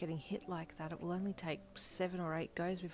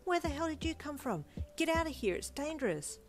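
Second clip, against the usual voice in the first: alarmed; 10 semitones higher than usual. A narrator's reading. Warning the girl away from the fight, alarmed. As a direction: shoo her away fast. Urging stranger to leave.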